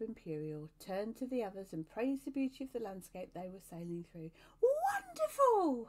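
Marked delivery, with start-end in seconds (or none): 4.6-5.9 high, long-drawn exultant cry; calling out. A narrator's reading. Cherub praising the landscape to the others, calling out. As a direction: cry it out loud, very high and stretched. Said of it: "he called out".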